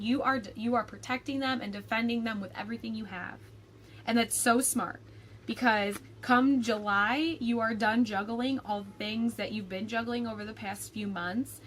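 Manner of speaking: ordinary talk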